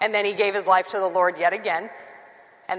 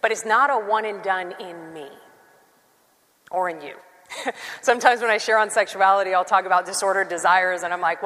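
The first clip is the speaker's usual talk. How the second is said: ordinary delivery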